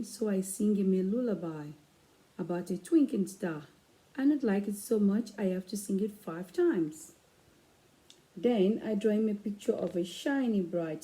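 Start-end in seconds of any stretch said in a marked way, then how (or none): none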